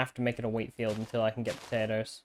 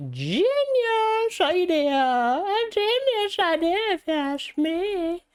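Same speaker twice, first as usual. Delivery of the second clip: high silly voice; 5 dB louder than usual. Pretend. Gamer hits on a genius idea, high silly boasting.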